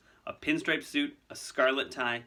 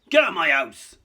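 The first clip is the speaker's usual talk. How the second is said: very loud, angry roar; furious shout; 6 semitones higher, 13 dB louder than usual. Acted. Hagrid ordering Malfoy out, furious shout. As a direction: throw the intruder out. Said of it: "said Hagrid furiously".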